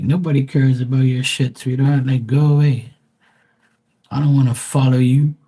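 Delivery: real irritation, loud and dismissive; annoyed rant. Real, not acted. Ranting at unwanted friend requests.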